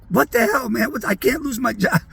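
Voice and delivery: playful high-pitched outburst of disbelief; mock alarm. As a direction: imitate a bandmate in a panic. Retold bandmate panicking about his job.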